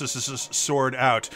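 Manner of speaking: ordinary talk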